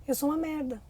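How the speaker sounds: quase sussurrado, bem mais baixo, como quem se rende; voz baixa, derrotada. Retold or imitated. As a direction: diga isso baixinho, para dentro, como criança que se culpa. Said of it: "ela registra um significado". (bem baixinho e derrotado)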